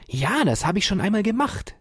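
put-on curious boy Ferdinand eagerly answering; eager young boy. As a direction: answer like a keen little boy. Ferdinand's child voice.

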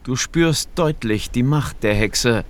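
plain reading voice

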